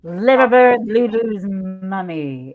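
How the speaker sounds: loud opening, then drawn out; big slow ceremonial hello; signature greeting. Shown as a demonstration. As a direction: belt the start, stretch the name out slowly. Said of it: "I always have to say it like that".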